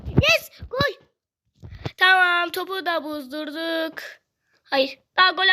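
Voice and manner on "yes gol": high, thrilled yelp of joy; sudden high goal cheer; excited cheer. Real, not acted. Celebrates a goal in game.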